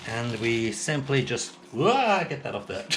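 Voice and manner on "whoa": surprised. Real, not acted. Something slips off the plate.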